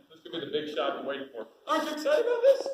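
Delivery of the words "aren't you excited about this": excited. Real, not acted. Laughing, excited question.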